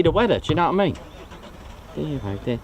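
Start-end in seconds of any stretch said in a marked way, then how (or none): 1.9-2.6 softer and lower, trailing off; quieter, lower repeat. Real, not acted. Left alone after Reggie leaves, softer and lower. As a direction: let it fall away under the breath.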